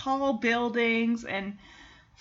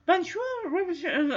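Bonjour drawn out higher in mock French; a French poodle's greeting; 7 semitones higher, slower than usual. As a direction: try Bonjour, higher and slow. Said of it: "she's like".

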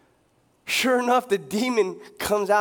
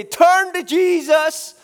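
hammed-up corner preacher yelling repent; bullhorn street preacher; 7 semitones higher, 7 dB louder than usual. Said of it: "they're on that bullhorn".

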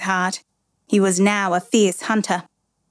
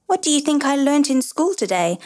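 put-on a touch of eager boy, higher; 6 semitones higher than usual. A narrator's reading. Boy trying to engage his father, lightly eager question. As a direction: ask it keenly, hoping to distract. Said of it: "I asked eagerly".

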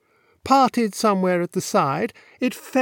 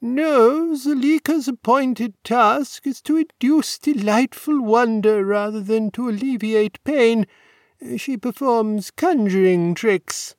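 lightly voiced proud old warden, higher; a hint of a fond grandfather explaining; elderly warden; 6 semitones higher than usual. A narrator's reading.

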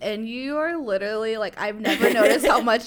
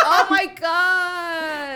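laughing hard, high and loud; 8 semitones higher, 5 dB louder, much slower than usual. Real, not acted. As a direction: burst out laughing, very slow. Laughing at wall in space.

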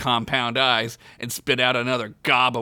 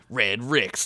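usual speaking voice

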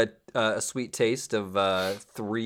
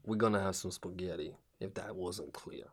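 mobster; 3 semitones lower, 11 dB quieter than usual. Pretend. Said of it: "he's a mobster".

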